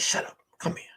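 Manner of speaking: soft, whispery hushing; quiet her gently under the breath; acted intimate murmur; hushed coaxing. Hushing her tenderly, retold.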